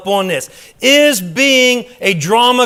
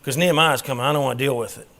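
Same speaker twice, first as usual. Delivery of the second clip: mock groan; 5 semitones lower, 4 dB quieter than usual. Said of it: "Everybody's like".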